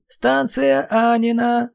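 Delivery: high, very slow loudspeaker voice; dry station announcement. Acted. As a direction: announce it like a metro speaker. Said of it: "сухо объявил".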